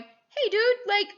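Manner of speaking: teacher voicing the Chinese, mock exasperated; imitating the Chinese. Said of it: "the Chinese going".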